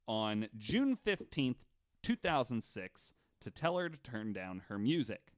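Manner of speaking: ordinary delivery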